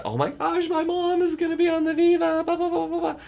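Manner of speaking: very high, loud squeal of delight; squealing excitement. Retold or imitated. Telling Len that mom will sail the Viva, squealing with delight. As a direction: gush like an overjoyed fan. Texting Len about mom's cruise.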